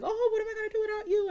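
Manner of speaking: high, rushed mock pleading; hammed-up impression of a clingy partner. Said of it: "that type of person that's like".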